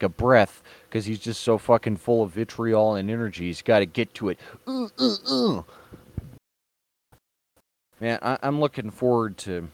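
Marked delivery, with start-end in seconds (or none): 4.7-5.6 high-pitched frantic stammered uhs; a squeaky overexcited sputter; mock sputter. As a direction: splutter like a man who can't stop talking. Mocking Tarantino's manic, breathless energy.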